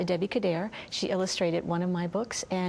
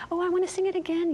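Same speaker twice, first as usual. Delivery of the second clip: put-on excited child voice; 12 semitones higher, faster than usual. Children asking to sing again.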